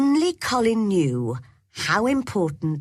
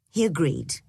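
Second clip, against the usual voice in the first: usual speaking voice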